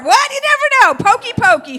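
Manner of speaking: high, loud cheeky singsong; playful teasing. Acted. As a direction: tease them naughtily. Playful mock poking.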